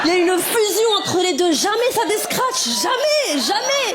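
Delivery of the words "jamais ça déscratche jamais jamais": high, drawn-out jamais, jamais rising in despair; exasperated high never. Acted. About pads stuck to her string, high wail.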